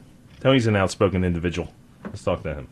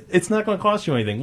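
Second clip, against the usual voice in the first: quick, pitched-up mock promise; mocking the campaign; 8 semitones higher, faster than usual. Host mocking the referendum campaign's promise, quick and high. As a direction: rattle off the empty promise, higher and fast. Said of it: "They went".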